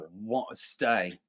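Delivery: low, soft, drawn-out British accent; British accent attempt. Shown as a demonstration. Co-host trying out a character's line, British accent. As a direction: say it quietly and slowly in a British accent. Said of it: "That was British".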